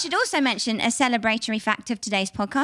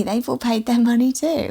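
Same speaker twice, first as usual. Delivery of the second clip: ordinary talk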